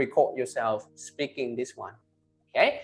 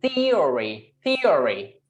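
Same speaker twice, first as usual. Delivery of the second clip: Theory with the first syllable stressed; 5 dB louder, much slower than usual. Shown as a demonstration. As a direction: lean hard on the THE of Theory. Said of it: "the first stress".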